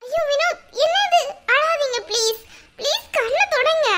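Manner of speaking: high-pitched, alarmed concern; distressed pleading. Acted. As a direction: plead with him, voice lifting in dismay. Wife comforts her crying husband. (பதறிப்போய் உயர்ந்த குரலில் கெஞ்சி)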